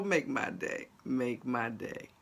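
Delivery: low, measured dare; tough [name] attitude. Real, not acted. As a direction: dare a troll to try it. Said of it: "[name] came out there".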